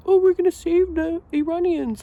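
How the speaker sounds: mocking Trump voice, high and loud; hammed-up Trump impression. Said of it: "Trump being like".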